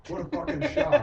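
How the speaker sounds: high, loud mock surprise; mock astonished outburst; sarcastic. Real, not acted. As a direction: feign shock, dripping with sarcasm. Sarcastic aside about critics.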